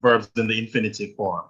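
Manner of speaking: usual speaking voice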